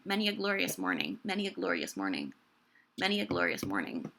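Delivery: "Many a" slid together quickly, repeated; said normally, elided. Shown as a demonstration. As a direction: run "Many a" together casually, as in speech. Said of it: "say it normally".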